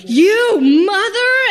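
drawn-out exclamation, high and loud; mock angry outburst. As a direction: blow up at him, stretching it slow. Outburst at husband in labor.

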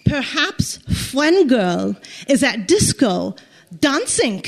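imitated German policeman, halting English; mock German accent. Young policeman suggesting where her friend is, in mock German accent. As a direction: put on a thick German accent, pausing before the last word. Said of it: "look and sound like Nazis".

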